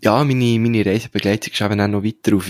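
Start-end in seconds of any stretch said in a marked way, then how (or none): none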